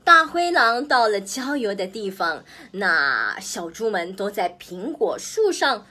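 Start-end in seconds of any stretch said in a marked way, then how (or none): none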